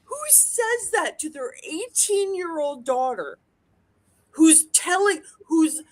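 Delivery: high, teary disbelief; tearful rant. Real, not acted. Daughter crying to friend about her mother, teary.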